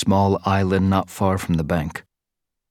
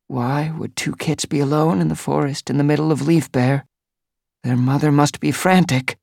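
higher, worried she-cat voice; worried queen cat; 8 semitones higher than usual. Acted. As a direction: fret for the lost mother.

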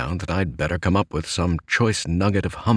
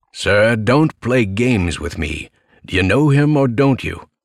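obstreperous sailor; 4 semitones higher, 6 dB louder than usual. A narrator's reading. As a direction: press the writer hard as an impatient sea captain. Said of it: "No need to be obstreperous".

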